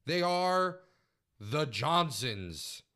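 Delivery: loud, drawn-out team reveal; grand announcer introduction. Acted. Co-host unveils the tag team, grand announcer introduction. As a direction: stretch the name out like a ring announcer.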